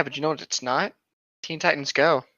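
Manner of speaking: ordinary delivery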